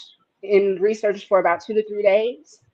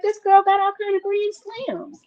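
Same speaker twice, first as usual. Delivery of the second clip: much higher, mock exasperated protest; incredulous outburst; 10 semitones higher than usual. Defending Serena to the critics, high incredulous cry. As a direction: throw it out like it's obvious to everyone. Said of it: "I'm like".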